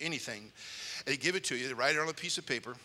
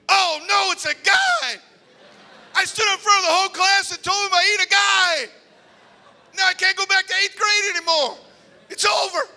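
much higher, loud panicked yell; horrified shout; 15 dB louder than usual. Retold or imitated. Boy realizes his speech mistake, panicked shout. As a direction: shriek it in mock horror.

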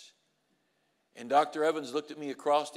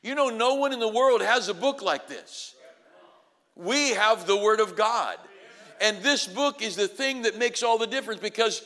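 loud, high, driving sermon voice; preaching at full force; 7 semitones higher, 8 dB louder than usual. Acted. Preacher rousing graduates about their vision, full-force preaching. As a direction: preach it out to the rafters.

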